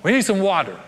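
playing a parched, fed-up Israelite snapping at the singer; irritated snap. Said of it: "Someone says".

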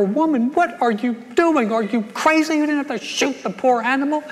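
hammed-up husband shouting in disbelief; retold yell. Husband yells at his wife after she shoots the horse, mock shouting. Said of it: "I yelled at her".

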